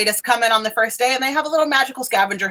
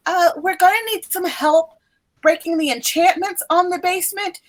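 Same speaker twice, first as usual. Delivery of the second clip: higher-pitched, earnest professor voice; brief the students in a raised pitch; professor figure, urgent; 6 semitones higher than usual. Acted. Professor asking upperclassmen for help with enchantments, urgent higher voice.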